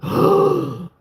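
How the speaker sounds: high, loud shocked intake of breath; sharp gasp. Retold or imitated. Describing a cold magnet to chat, a hammed-up high gasp. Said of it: "you're like".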